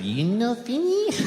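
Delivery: put-on high, slow Japanese-accented question; waitress asking about the leftovers in a Japanese accent. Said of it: "I have to do the accent".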